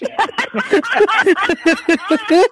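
shrieking rising laugh; high laughing fit. Real, not acted. Big laugh at joke.

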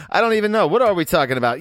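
normal talking voice

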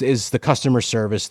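ordinary talk

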